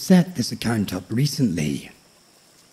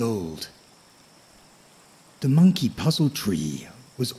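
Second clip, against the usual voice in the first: nature documentary. A narrator's reading. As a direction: lull the listener toward sleep. Sleep story narration of tree.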